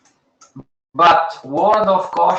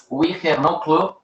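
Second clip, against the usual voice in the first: ordinary delivery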